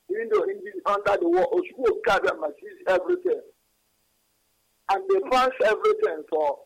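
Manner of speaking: high-pitched impassioned preaching; fervent preaching. Acted. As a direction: preach it with fire. Caller preaching with rising fervour.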